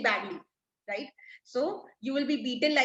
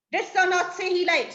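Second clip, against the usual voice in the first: annoyed; 6 semitones higher, 4 dB louder than usual. Acted. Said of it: "Stefano is annoyed".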